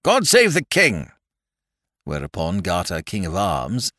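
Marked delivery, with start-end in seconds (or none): 0.0-1.1 ringing, high, loud cry; emphatic proclamation. A narrator's reading. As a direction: proclaim it across a great hall. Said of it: "retorted with great emphasis".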